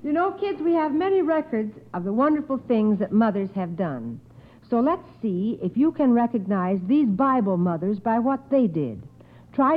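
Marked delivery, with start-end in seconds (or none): none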